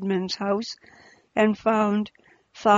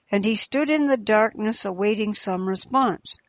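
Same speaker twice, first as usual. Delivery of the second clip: plain reading voice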